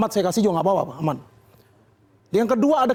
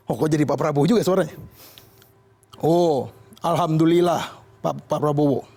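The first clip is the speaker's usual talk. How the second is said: mock Jokowi; 5 semitones lower than usual. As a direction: sigh it out with relief. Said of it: "Pak Jokowi juga membalas".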